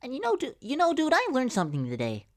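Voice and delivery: squeaky high cartoon voice, loud on dude; high cartoon-style quote; high-pitched friend voice. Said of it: "Some-, something like that".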